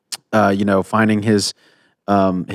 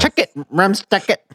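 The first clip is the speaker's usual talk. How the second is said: B-Real impression; 9 semitones higher than usual. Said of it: "Uh, be, be real".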